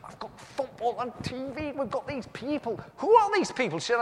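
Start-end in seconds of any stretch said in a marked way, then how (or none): 0.0-2.8 higher, pleading complaint to his wife; husband pleading with wife over football night; whiny protest. Retold or imitated. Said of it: "I said". 3.0-3.8 high exasperated. Retold or imitated. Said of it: "exasperated question".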